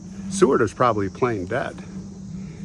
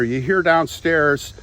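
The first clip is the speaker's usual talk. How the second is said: plain reading voice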